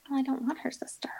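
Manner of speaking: hushed, quick whisper of refusal. Retold or imitated. Telling the breeder she doesn't want the sister, whispered. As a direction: mutter it under your breath, fast, to yourself.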